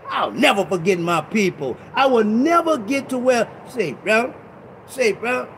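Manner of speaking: ordinary talk, nothing marked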